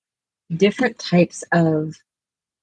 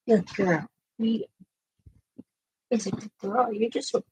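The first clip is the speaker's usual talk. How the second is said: higher, gentle sing-song pet talk; talking to the dogs; 5 semitones higher, faster than usual. Real, not acted. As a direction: soothe the restless dogs like babies. Soothing her dogs at bedtime.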